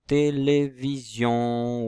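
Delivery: Télévision said slowly and a bit loudly, té-lé-vi-sion; slow syllabified demo. Shown as a demonstration. Teacher to pupils, sounding out the word slowly. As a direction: spell out Télévision by ear. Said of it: "où est-ce qu'on entend le son et?".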